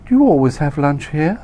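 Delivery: high and loud, eager; Candy's high voice. Acted. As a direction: ask brightly, flirting. Candy asks him.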